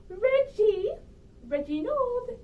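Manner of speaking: loud, high call of his name. Acted. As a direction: shout for him from offstage. Calling husband offstage.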